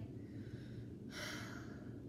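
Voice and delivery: long, airy demonstrated sigh; big sigh. Shown as a demonstration. Storyteller shows children how Bear sighs, exaggerated breathy sigh. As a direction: let out a long soft sigh. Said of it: "can you sigh?".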